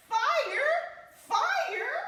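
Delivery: loud, high shout of alarm; shouting out the warning; alarmed cry. Acted. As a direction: raise the alarm at full voice. Spotting smoke, calling alarm.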